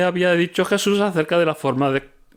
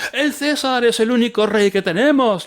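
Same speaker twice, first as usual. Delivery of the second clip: defiant crowd retort; 8 semitones higher, 3 dB louder than usual. Acted. Nobles retort to Pilate.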